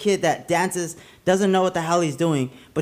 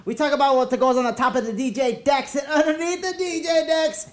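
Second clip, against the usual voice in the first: hype man intro; 5 semitones higher than usual. Acted. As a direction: pump up the crowd like a club MC. Podcast host's hype intro.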